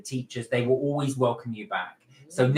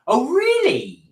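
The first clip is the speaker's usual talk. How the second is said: surprised; 11 semitones higher, 7 dB louder, slower than usual. Real, not acted. Reacting to a comment.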